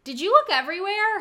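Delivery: high, loud call from another room; shouting from the shower; shouted question. Retold or imitated. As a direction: yell it through the door. Calling from shower.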